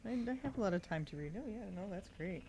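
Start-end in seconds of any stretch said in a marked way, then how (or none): none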